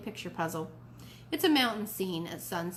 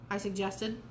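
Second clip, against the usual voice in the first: plain reading voice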